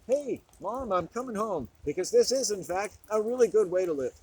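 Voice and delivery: excited call home. Pretend. Imagined happy call home.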